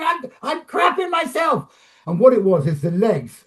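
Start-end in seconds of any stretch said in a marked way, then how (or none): 0.0-1.7 high, strained voice of a terrified man; scared shout from above. Retold or imitated. Cinematographer stuck on the cherry picker, high frightened shout. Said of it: "He's going".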